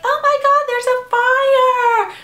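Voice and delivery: high, loud, drawn-out panicked cry; mock panic. Mocking the roommate panicking about the kitchen fire. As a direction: shriek it like a ditzy roommate who panics. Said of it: "And she's like".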